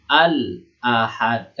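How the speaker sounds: slow "Al-Ahad", the l held and fully sounded; clearly read lam. Shown as a demonstration. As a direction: recite "Al-Ahad" with every letter distinct. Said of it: "Kan dibaca semua kan, jelas".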